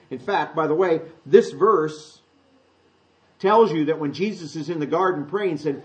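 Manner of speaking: ordinary talk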